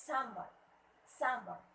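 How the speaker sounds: "someone" said as salmon with no L sound; silent L. Shown as a demonstration. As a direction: say "someone" as SAM-un, stressed on the first syllable. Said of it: "it's silent L".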